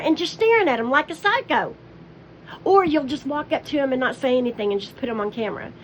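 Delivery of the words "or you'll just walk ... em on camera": ordinary talk, nothing marked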